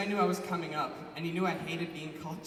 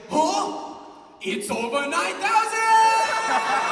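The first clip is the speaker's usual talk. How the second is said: much higher, much louder, slow sung shout; anime shout; 13 semitones higher, 10 dB louder, much slower than usual. Pretend. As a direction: scream it like an anime villain. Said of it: "be like".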